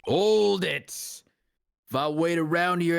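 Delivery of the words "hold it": objection shout. Acted.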